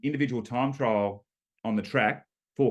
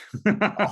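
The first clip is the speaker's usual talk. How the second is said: laugh; 9 semitones higher, 4 dB louder than usual. Real, not acted. Laughing at losing to Matthews.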